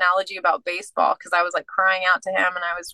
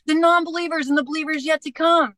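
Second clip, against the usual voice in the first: excited answer; 8 semitones higher than usual. Retold or imitated. Retelling her excited answer to God.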